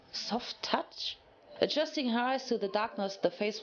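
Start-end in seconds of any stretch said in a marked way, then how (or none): 0.1-1.2 whispered. A narrator's reading. Waking confused in the dark.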